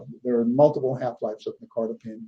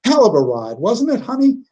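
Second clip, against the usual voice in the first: louder, rising high on honey, a lightly voiced chummy nurse; folksy nurse teasing; 9 semitones higher, 7 dB louder than usual. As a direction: cheerfully tease the shaken old nun. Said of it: "and she goes".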